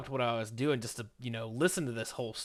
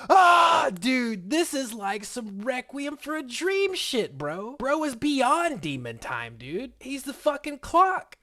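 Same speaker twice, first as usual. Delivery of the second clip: much louder and higher, yelling in disbelief; shocked outburst; 10 semitones higher, 8 dB louder, slower than usual. Real, not acted. Telling viewers about Steve's story in a loud, shocked yell. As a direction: burst out in stunned outrage, drawing out the words slowly.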